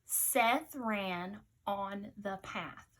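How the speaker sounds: slow, evenly spaced words; slow dictation pace. Shown as a demonstration. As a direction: dictate each word slowly. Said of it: "Listen again".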